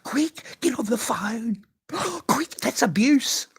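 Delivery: high, breathless whispered panic; panicked neighbour. Retold or imitated. Mocking a nosy neighbour overhearing him, in breathless high panic. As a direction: gasp and whisper like a frantic eavesdropper. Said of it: "he said".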